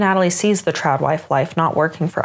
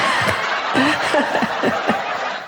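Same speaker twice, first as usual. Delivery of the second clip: laugh; 8 semitones higher than usual. Real, not acted. Laughs at own joke.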